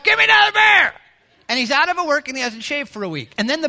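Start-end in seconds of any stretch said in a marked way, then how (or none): 0.0-0.9 roaring demand, much louder and higher; hollering at wife. Acted. Couch potato husband hollering at his wife for beer. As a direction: bellow at the wife from the sofa. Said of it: "he's hollering at his wife".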